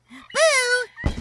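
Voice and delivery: very high, loud spook shout; playful boo. Acted. Porcupine popping out of the leaves to scare. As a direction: leap out with a boo. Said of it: "shouted Porcupine".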